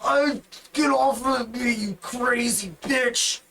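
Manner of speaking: a lightly voiced angry minotaur. Minotaur yelling at the goblin on top of him, lightly voiced. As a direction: shove her off, mildly annoyed. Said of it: "the minotaur is just like".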